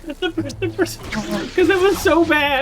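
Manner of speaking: high, squeaky laughing speech; laughing through words. Real, not acted. Streamer recalling how bad the accent was, laughing through words. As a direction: barely get it out through laughter.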